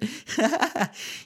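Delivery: high squeaky giggle. Real, not acted. Reader cracks up again.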